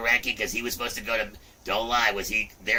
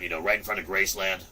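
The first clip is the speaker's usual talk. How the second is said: usual speaking voice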